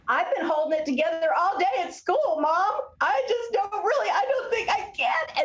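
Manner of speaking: put-on high-pitched, laughing-crying kid on the edge; overwhelmed child. Mom hearing her child finally crack after school, high, teary. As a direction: voice a child about to break, laughing through tears.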